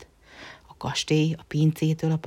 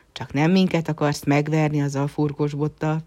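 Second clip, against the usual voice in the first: plain reading voice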